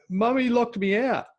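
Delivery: put-on little girl's complaint. Little sister tells dad, in a high child voice. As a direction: whine it like a hurt four-year-old. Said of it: "And then he's like".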